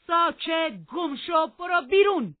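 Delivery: high, full-force angry yell; furious shout. A narrator's reading. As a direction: shout them out of the room. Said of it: "هیتکلیف داد زد".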